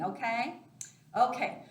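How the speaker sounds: normal talking voice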